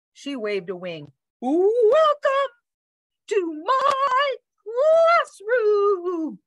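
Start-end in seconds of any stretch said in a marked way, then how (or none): 1.4-6.4 high, slow, theatrical sing-song; grand bird teacher. A narrator's reading. Bird welcomes the dog to her classroom, grand and high. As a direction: announce it high, every word stretched.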